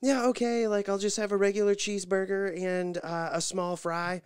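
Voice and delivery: unfazed and chirpy, she carries on; imitating Nikki. Wife reorders after the cashier's snub, hammed-up and breezy. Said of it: "Nikki just doesn't miss a beat".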